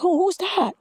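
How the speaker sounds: high, light mock onlooker; curious onlooker. Courtyard onlookers spotting her, lightly voiced curiosity. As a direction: ask it like a nosy bystander. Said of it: "they're like".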